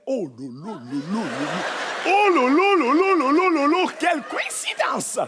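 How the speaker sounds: caricatured astonished lady. Retold or imitated. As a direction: squeal it like a shocked lady. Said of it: "elles adorent faire".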